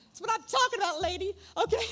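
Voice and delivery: giddy self-satisfied cheer; proud triumph. Retold or imitated. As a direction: crow over the win. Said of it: "And I thought it was me".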